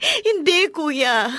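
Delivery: pleading through tears; tearful plea. Acted. Wife pleads with angry brother. (naiiyak na pakiusap)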